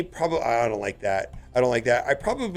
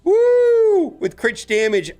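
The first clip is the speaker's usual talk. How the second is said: high, loud whooping delight; real thrilled shouting, drawing out the big words; excited; 13 semitones higher, 6 dB louder, slower than usual. Real, not acted. Player cheering a lucky gear roll, excited. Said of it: "I'm getting excited now".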